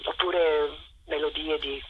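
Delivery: normal talking voice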